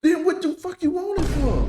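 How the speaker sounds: loud, indignant yell; angry shout. Real, not acted. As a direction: shout it out in disbelief. Angry reaction to clip.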